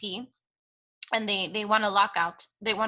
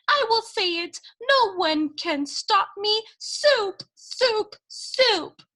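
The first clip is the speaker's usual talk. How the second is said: much higher, defiant voice breaking into tears; defiant tearful Cook; 11 semitones higher, slower than usual. Acted. Cook defying Louise before sobbing, high and tearful. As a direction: repeat it like a stubborn, weeping child.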